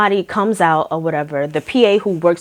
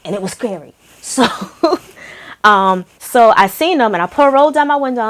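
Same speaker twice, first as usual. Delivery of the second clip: usual speaking voice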